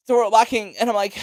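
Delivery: usual speaking voice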